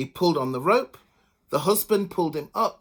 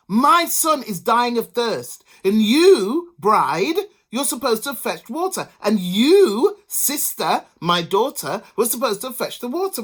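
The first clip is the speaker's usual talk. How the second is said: loud, high angry rebuke; scolding mother; 6 semitones higher, 7 dB louder than usual. A narrator's reading. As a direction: tell them off loudly. Mother scolds the two women.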